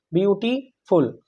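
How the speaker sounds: Beautiful slowly, pausing before फुल; slow, segmented beautiful; broken into syllables. Shown as a demonstration. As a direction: say beauty, stop, then फुल. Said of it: "तीन या उससे ज़्यादा बार आप रुक रहे हो".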